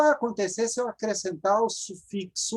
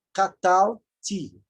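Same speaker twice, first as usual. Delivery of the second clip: 1 slow model word; much slower than usual. Shown as a demonstration.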